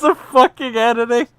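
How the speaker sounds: shrieking, very loud and high; hysterical laughter. Real, not acted. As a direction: scream it through laughter. Screaming laughing disbelief.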